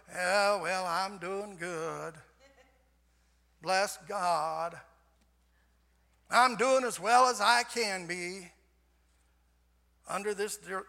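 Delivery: slow, drawn-out, put-on weary voice; mock downtrodden. Believer answering how he's doing under the circumstances, mock downtrodden. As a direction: drag it out as if weighed down.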